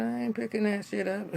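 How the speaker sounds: mock sassy refusal, giggling; laughing refusal. Retelling Hannah's refusal to pick up the coat, laughing.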